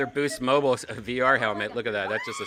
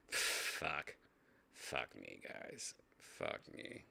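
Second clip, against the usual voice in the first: whispered, much softer, fed up; exasperated whisper; 13 semitones lower, 15 dB quieter, much slower than usual. Real, not acted. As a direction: breathe the curse out under your breath. Exasperated by the video.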